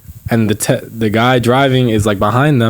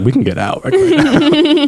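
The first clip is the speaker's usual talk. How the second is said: laughing mom quote. Retold or imitated. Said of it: "my mom's like".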